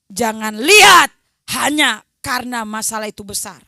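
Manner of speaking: loud preaching to the congregation; full-force preaching. Acted. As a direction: drive it home to the church.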